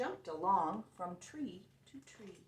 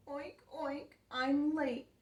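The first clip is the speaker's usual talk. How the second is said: mild weeping piggy; 7 semitones higher, 4 dB louder than usual. Acted. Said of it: "poor Piggy wept".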